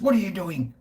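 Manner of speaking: mock the cranky shopkeeper's challenge; a hint of an annoyed shop owner asking; angry shop woman. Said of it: "and the woman goes".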